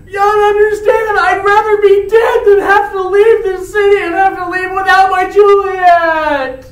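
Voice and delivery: high, loud, desperate shouting with a sob; Romeo pleading. Retold or imitated. Romeo begs the friar for death, sobbing shouts. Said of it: "And Romeo goes".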